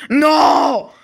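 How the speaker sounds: loud crying no; anguished shout. Acted. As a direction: wail it out in despair. Discovers his bed sold.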